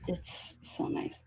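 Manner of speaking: breathy whisper of pleasure. Real, not acted. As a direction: sigh it out, savoring. Savoring favourite whiskey.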